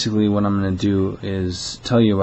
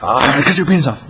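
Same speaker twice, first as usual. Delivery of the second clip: quick, raised-pitch caller impression; mock angry outburst, fast and higher; 6 semitones higher, 4 dB louder, faster than usual. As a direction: toss off the threat as the irate caller. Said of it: "he's like".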